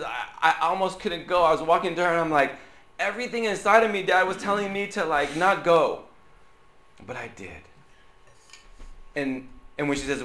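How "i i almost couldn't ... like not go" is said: high, loud, rushed daughter voice full of reluctance; imitating his daughter. Pastor acting his daughter's dread, higher and louder. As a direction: voice the girl whose feet won't move. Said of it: "it's like she says".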